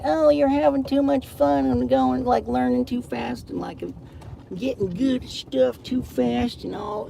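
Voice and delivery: high, loud mocking voice of a nagging scold; mocking whiny scold. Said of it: "They're just like".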